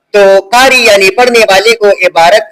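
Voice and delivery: slow, measured reading of a definition; formal dictation-style reading. Shown as a demonstration. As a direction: read it out slowly, phrase by phrase. Reads the definition on.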